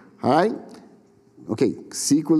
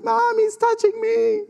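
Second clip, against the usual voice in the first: put-on high whiny kid voice, tattling; whiny tattling little sibling. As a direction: whine it like a tattling little sister. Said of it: "she's like".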